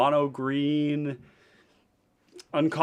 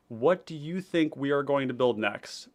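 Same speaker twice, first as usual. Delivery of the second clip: ordinary talk, nothing marked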